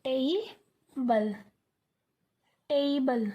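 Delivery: टेबल broken slowly into tei and bal; syllable-by-syllable टेबल with an ei diphthong; correct pronunciation demo. Shown as a demonstration. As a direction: stretch टेबल, gliding e into i before bal. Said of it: "इसको क्या बोलते हैं".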